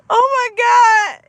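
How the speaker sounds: high, loud burst of real laughter; cracking up, gasping out the words; laughing hard. Real, not acted. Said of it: "caught me in the middle of laughing".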